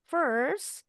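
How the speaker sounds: "First" swept upward at the end; rising comma intonation. Shown as a demonstration. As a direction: lift "First" high, as before a comma. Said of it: "kalau pakai tanda koma tuh intonasinya naik". ("First" dengan intonasi naik seperti ada koma)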